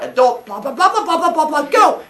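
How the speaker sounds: high, loud dismissive babble; mocking teenager. Teacher voicing Phaethon tuning out his father, high mocking babble. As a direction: brush off the grown-up and rush to go. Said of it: "he's like".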